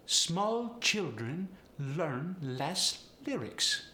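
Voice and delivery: Polish accent with overemphasized L; slow, high Polish accent, leaning on every L. Shown as a demonstration. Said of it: "The same is true with the L".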